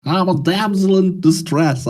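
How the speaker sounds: high, loud Southern belle; Southern damsel accent. Pretend. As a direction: play a Southern damsel in distress. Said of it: "my fucking Southern accent is shit".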